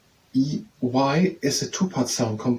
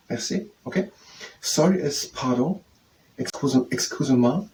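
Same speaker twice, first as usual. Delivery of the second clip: ordinary talk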